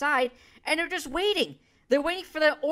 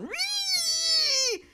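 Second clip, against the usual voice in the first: high, shrill-sounding mocking "Ree!" screech; mock lib screech. Mocking a liberal's outrage with a high screech. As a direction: squeal it like a triggered liberal. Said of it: "A lib going".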